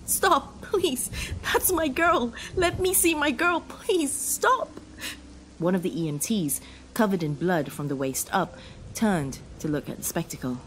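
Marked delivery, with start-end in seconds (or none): 0.0-4.8 high, broken, begging cries; anguished pleading. Acted. Grieving man begging to see his wife. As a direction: plead through grief, barely holding on.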